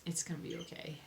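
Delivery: soft, hushed, to herself; quiet self-reassurance. Real, not acted. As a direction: murmur it to calm yourself. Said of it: "that's what I keep telling myself".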